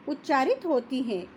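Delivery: normal talking voice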